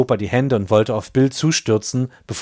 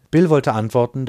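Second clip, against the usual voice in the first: ordinary delivery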